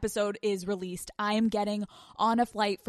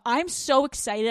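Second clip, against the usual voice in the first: ordinary talk, nothing marked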